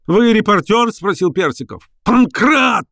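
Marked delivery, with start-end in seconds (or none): 0.1-0.9 high-pitched suspicious demand; alarmed indignation. A narrator's reading. Professor confronting new visitor, high and indignant. Professor suspects another reporter. 2.0-2.9 high, urgent call; angry shout. A narrator's reading. Professor summoning Pankrat against reporter, angry shout. Professor calls servant in rage.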